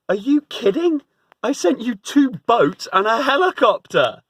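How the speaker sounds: high, loud, incredulous God with a laugh; God laughing at the man's foolishness; exasperated God. Retold or imitated. God answering the drowned man in high, loud, laughing disbelief.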